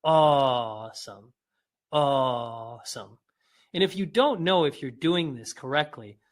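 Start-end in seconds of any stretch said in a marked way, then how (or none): 0.0-3.2 AWESOME very slow, AW held long and falling; long, wondering "awesome" as an exercise; drawn-out demonstration. Shown as a demonstration. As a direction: stretch the first vowel of AWESOME, pitched lower.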